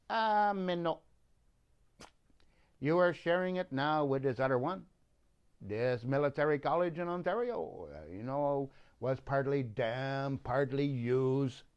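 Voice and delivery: put-on French accent. The government of Canada telling Mr Stanley, in a mock French accent. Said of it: "I had to do the French accent thing".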